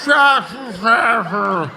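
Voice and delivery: drawn-out, slow, laughing slurred ugh; loud slow slurred grunt mocking the heckler; mock slurred groan. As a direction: mimic a drunk heckler's mangled groan. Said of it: "you sounded like you just had a fucking stroke".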